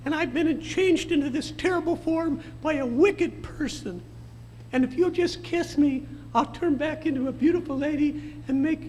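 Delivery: very high squeaky pleading lady-frog. Retold or imitated. Frog begging the old man for a kiss, very high voice. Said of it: "the frog looks up at him and says".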